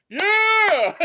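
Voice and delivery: high, loud mock cheer of a crowd; mocking crowd whoop. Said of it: "people are like".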